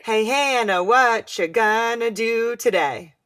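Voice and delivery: every second syllable hit in "Hey, Hannah, what you gonna do today"; slow, loud sing-song beat, weak-strong throughout; iambic pentameter. Shown as a demonstration. Co-host echoes the question, exaggerated iambic pentameter. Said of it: "Nice iambic pentameter".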